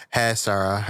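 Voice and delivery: put-on Valley Girl accent. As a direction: drawl it like a bored Valley Girl. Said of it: "Like a Valley Girl and shit".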